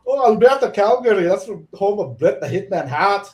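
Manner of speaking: high, loud Scottish gabble; thick mock-Scottish, high and loud; imitated Scottish accent. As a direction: imitate an excitable Scotsman, pitched right up. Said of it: "Scottish dude".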